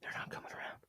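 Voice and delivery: sudden unvoiced whisper. Real, not acted. Host whispering to listeners behind his co-host, whisper. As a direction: hiss it as a secret aside. Aside undercutting the quote.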